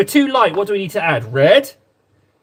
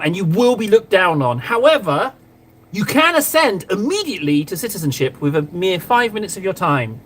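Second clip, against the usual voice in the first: ordinary talk, nothing marked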